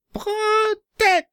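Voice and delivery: high, drawn-out shriek; shrieking demon. Acted. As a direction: shriek like a possessed creature. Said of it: "kreischte sechs sechs sechs".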